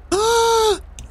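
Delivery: high sudden gasp of recognition; sharp gasp of surprise; sharp excited gasp. Acted. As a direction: gasp as if you spot someone. Spotting long-lost mother.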